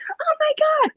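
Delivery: very high mock squeal; mom freaking out. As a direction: imitate an ecstatic mother's shriek. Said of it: "My mom's still freaking out".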